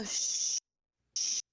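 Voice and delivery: long voiceless shushing; hushing shh. Acted. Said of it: "I'm gonna go".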